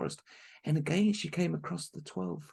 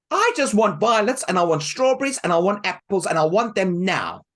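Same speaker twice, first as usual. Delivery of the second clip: loud, higher rude demand; 6 semitones higher, 13 dB louder than usual. Acted. Helen demanding fruit from the months, loud and rude. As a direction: demand it rudely.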